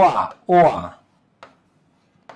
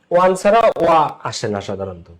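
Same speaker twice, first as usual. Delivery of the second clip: normal talking voice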